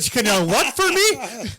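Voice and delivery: loud, slow mock disbelief; acted bafflement. Customer answering the store clerk, acted baffled outburst.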